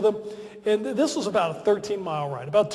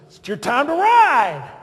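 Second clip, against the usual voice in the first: slow, louder, higher mock order; drawn-out playful order from the boss; 1 slow teasing command; 5 semitones higher, 6 dB louder, slower than usual. President voiced, teasing command.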